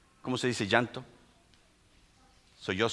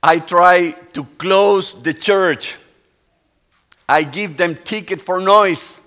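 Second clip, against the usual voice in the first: crying confession; 7 semitones higher, 15 dB louder, slower than usual. Retold or imitated. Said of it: "And he was crying".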